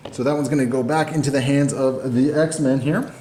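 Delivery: normal talking voice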